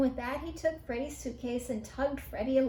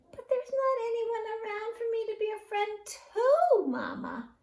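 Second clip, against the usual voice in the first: put-on child mouse voice; 9 semitones higher, 4 dB louder than usual. Young mouse voiced in story.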